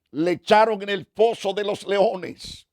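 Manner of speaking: normal talking voice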